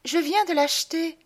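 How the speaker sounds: high-pitched, delighted woman's voice; proud fiancée. Acted. Madelon shows off her new lapdog, proud and high-pitched. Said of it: "elle me montre toute fière".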